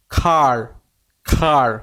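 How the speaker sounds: খার said with a wide-open, held "ar"; open-mouthed AR. Shown as a demonstration. Teacher showing learners how to say it, খার with an open "ar". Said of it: "এইভাবে বলতে হবে".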